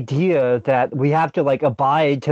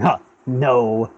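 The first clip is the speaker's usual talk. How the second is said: stoic grunt. Retold or imitated. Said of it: "he's very stoic looking".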